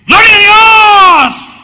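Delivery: slow, high, drawn-out bellow of praise; imitating a loud worshipper in the third row; excessive shout. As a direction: yell it way over the top, stretching every word. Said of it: "que gritaba desmedidamente".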